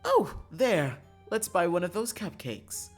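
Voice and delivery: high, loud burst of delight; excited. Acted. Karma spots cupcakes while out shopping, excited. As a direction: exclaim it as if just spotting a treat.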